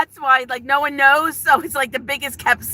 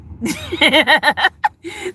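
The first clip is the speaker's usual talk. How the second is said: high giggle; laugh; 5 semitones higher than usual. Real, not acted. Streamer amused at being caught live at school, giggling. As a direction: burst into a giggle.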